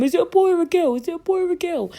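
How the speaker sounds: high excited calling out; mock eager cabbies; eager cab drivers. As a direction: shout it up high like curious onlookers. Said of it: "they were like to Mum".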